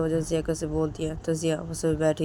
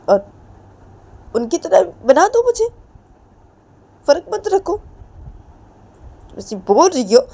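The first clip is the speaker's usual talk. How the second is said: much higher and louder, sarcastic; bitter angry pleading; 8 semitones higher, 9 dB louder than usual. Acted. Ritu taunting Jiya, much higher louder bitter shout. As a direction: hurl it at her, wounded and defiant.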